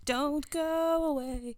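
high, slow pleading call; playful mock plea. Begging listeners to stay.

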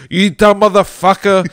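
a head yelling at its clumsy body; mock angry head. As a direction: scold your own body, cracking up. Head scolds its own body.

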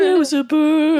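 very high and loud; squeaky high voice. Pretend. Passing the line, growing sillier.

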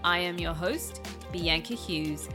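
smooth host intro read over music; podcast intro. Acted. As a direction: read it like a polished show opener.

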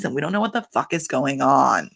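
ordinary delivery

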